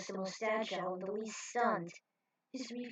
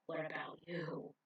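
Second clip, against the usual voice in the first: whisper; 4 semitones lower, 7 dB quieter than usual. Acted. Quiet question to Roger.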